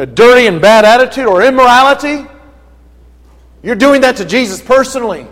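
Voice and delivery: ordinary delivery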